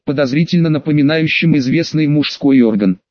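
usual speaking voice

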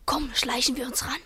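breathy hushed whisper; whisper, creeping closer. Acted. Sneaking up in the dark. (flüsternd beim Heranschleichen)

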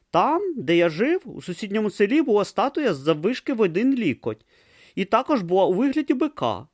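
put-on high, earnest young novice voice; a naive boy talking to a turtle; young novice Brutha. A narrator's reading. Young novice offers the tortoise lettuce, high and earnest.

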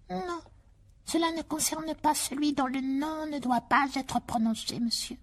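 high, slow house-elf voice; slow, high, earnest elf; house elf Dobby. Acted. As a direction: squeak it out like an earnest little elf.

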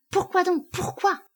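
very high, pleading repeated question; anguished questioning. A narrator's reading. Wife holding a mirror to her husband, anguished questioning. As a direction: plead POURQUOI high, falling away.

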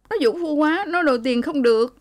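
put-on high, aggrieved young woman's voice; hurt accusing daughter. A narrator's reading. Daughter accuses her husband to her mother, high and aggrieved. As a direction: blame the brutal husband.

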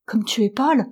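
lightly acted frightened concern; murmure effrayé. A narrator's reading. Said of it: "murmura-t-elle effrayée". (légère frayeur, à peine murmurée)

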